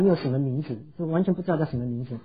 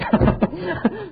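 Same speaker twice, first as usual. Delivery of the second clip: high-pitched burst of laughter; amused laugh; 14 semitones higher than usual. Real, not acted. Preacher amused by mothers hushing their children, amused laugh. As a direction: laugh right after the punchline.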